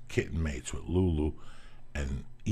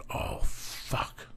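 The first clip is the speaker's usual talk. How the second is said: whispered curse, caught sneaking in. Retold or imitated. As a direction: breathe it out so nobody hears. Sneaking in, wife's light on.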